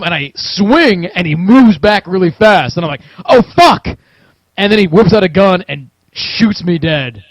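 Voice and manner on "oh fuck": loud, high alarmed curse; startled outburst. Retold or imitated. Player reliving the attacker dodging his hatchet, loud panicked swearing. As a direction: yelp it as the swing misses. Retold shock as attacker dodges.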